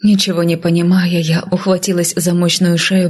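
usual speaking voice

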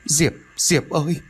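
high tearful pleading voice; on the verge of crying with relief. A narrator's reading. Mother calling her waking daughter, tearful and high. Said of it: "Bà Xiêm liền mếu máo".

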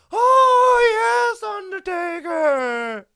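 very high, loud, slow wail; Paul Bearer impression. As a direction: shriek it, long and drawn out. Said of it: "Percy Pringle had the most amazing".

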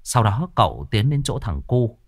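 usual speaking voice

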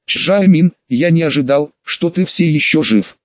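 plain reading voice

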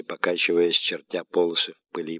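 usual speaking voice

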